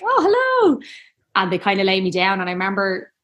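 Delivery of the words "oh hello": high, bright-eyed mock surprise; a startled, much higher oh hello; mock surprised hello. Retelling meeting the midwife, mock surprised.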